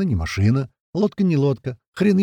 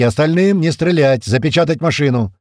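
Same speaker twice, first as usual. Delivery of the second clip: shouting; 5 semitones higher, 6 dB louder than usual. A narrator's reading. Commander ordering the vehicle sealed, shouting. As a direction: bellow orders over gunfire. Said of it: "прокричал Туман между выстрелами".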